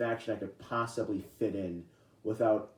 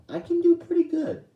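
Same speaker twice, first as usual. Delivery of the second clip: sudden very high, louder squeak to show off his range; squeaky voice demo; 8 dB louder than usual. Shown as a demonstration. Vlogger showing off his voice range with a high squeaky voice. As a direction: jump way up into a squeaky cartoon voice. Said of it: "I can do some pretty decent voices".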